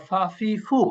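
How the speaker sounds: ফা, ফি, ফু with lip and teeth, like Bangla ফ; drilling f sounds. Shown as a demonstration. Teacher drilling the class on ফা ফি ফু. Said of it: "Bangla ফয়ের মতো".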